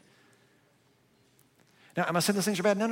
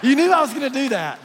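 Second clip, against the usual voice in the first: laughing shout; 10 semitones higher, 10 dB louder than usual. Real, not acted. Teasing crowd after a cliffhanger.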